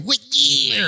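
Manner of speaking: high, loud cartoon yell; South Park character voice. Friend mocking the hero's first line, cartoonish shout. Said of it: "he sounds like a South Park character".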